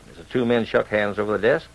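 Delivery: plain reading voice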